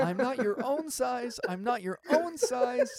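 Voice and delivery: high mocking imitation, stress on own; mocking weird line reading. Mocking the film's bad line, high and loud. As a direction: parrot the awful delivery mockingly. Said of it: "how do you say it like that".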